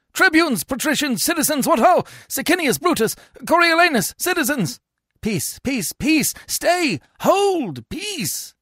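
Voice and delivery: high, urgent calls over a commotion; crying out. A narrator's reading. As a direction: call out like a crowd in uproar. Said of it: "they all cry out".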